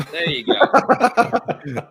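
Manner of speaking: mock presentation. Presenting the figure to the fans.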